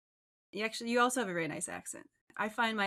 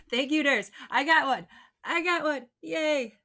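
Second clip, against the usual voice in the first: louder, higher, celebrating the first reply; excited cheer; 5 semitones higher, 10 dB louder than usual. Real, not acted. Host cheering loudly when a viewer finally answers.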